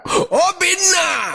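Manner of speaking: high, slow shout for Obinna; long desperate call. Retold or imitated. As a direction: call the boy from the flood. Drowning father calling child.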